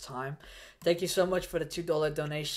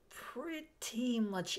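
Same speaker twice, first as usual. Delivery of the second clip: higher, slow stretched pretty much it; drawn-out repeat; 8 semitones higher, 4 dB quieter, much slower than usual. Real, not acted. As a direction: stretch the words out slowly, a little higher.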